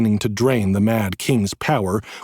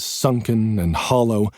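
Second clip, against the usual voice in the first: ordinary delivery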